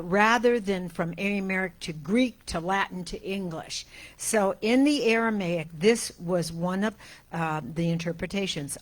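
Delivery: usual speaking voice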